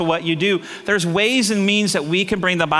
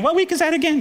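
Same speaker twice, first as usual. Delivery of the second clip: high, quick clueless question; lightly mocking, pitched up and rushed; clueless guys; 11 semitones higher than usual. Preacher imitates guys asking about the week, mock clueless. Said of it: "Guys are like".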